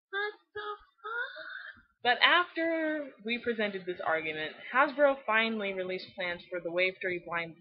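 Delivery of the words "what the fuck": slow, high, disbelieving what the fuck; incredulous. Real, not acted. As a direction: draw it out in stunned disbelief. Reacting to Lula Moon name.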